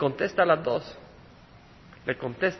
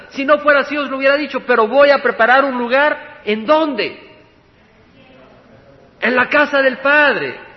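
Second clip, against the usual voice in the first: loud, raised preaching voice; forceful preaching; 6 semitones higher, 7 dB louder than usual. Acted. Preacher quoting Jesus to the congregation about the Father's house, forceful preaching. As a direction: drive the scripture home, loud and high.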